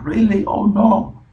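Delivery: very high, loud cry of disbelief; shocked reaction. Acted. Teacher showing how Pedro reacts, shocked and dismayed. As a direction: act out startled dismay. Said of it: "se impresiona, reacciona de esta manera".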